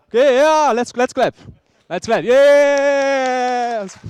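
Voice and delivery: high, loud rallying shout ending in a long yell; cheering shout. Real, not acted. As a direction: whoop up the crowd for applause. Rousing the audience to clap.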